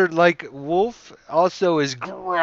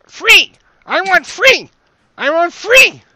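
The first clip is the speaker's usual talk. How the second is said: very high, loud creature squeal; screaming, whimpering kobold; 12 dB louder than usual. Acted. Game master voices a trapped kobold screaming to get free, frantic squeal. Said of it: "screaming and whimpering that he wants free".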